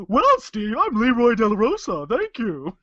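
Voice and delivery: high, loud put-on wrestler voice; luchador voice. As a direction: play a proud luchador. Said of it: "two characters in one sentence".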